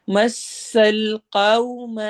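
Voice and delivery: slow melodic tajweed chant; measured Quranic recitation. Shown as a demonstration. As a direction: recite slowly, stretching the long vowels. Teacher recites next phrase.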